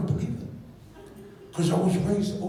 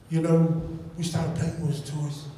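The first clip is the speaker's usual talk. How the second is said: ordinary talk, nothing marked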